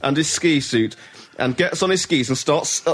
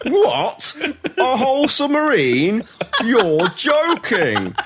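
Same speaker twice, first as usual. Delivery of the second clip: mock disbelief; 11 semitones higher, much slower than usual. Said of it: "He's on the phone going".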